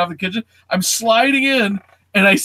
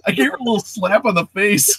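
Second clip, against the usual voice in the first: ordinary talk, nothing marked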